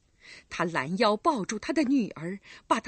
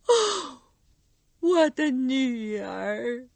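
heartfelt cry; 4 semitones higher, 5 dB louder, much slower than usual. Acted. Recluse recognizes her daughter, slow heartfelt cry. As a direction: call out from the heart. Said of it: "用发自肺腑的声音喊道".

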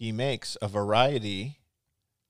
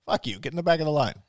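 raised, mock-outraged scolding; mock indignant rant; 5 semitones higher, 5 dB louder than usual. Mock rant at line cutter.

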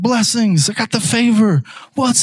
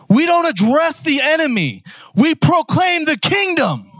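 raised, shouted sermon lines; forceful preaching; 6 semitones higher than usual. Acted. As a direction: preach it like a battle cry. Preaching climax.

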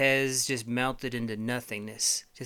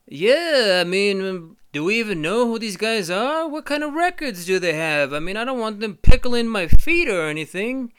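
high, loud fussy piggy bank; Ham; 8 semitones higher, 11 dB louder than usual. Acted. Ham the piggy bank worrying about the homies, loud and high. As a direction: fret like a nosy neighbor. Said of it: "And then Ham, the little fucking piggy bank, comes over".

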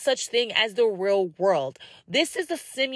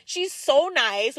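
excited gush; 7 semitones higher than usual. Retold or imitated. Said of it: "And I was like".

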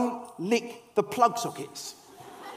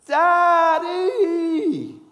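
long, high, loud wail for dad; shouted child's cry; 10 semitones higher, 9 dB louder than usual. Retold or imitated. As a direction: belt it out, high and drawn out like a muddy little boy. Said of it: "He shouted".

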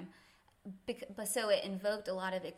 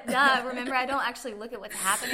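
laughing, playful mock defense; teasing herself with a giggle; 4 semitones higher, 12 dB louder, faster than usual. Joking self-parody, laughing.

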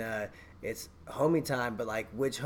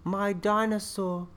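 put-on British kid accent; 6 semitones higher than usual. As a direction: say something gnarly sweetly in a little British accent. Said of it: "he's like".

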